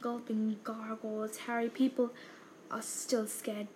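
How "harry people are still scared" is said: ordinary delivery, nothing marked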